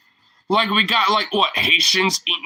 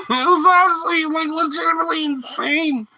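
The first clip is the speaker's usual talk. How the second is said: incredulous high exclaim; 13 semitones higher than usual. Real, not acted. Vlogger marvels at the pundit's rant, incredulous high exclaim. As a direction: pitch shoots up high in disbelief.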